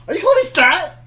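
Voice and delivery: high, loud mock outrage; playfully offended squeal. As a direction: act hurt and indignant, loudly.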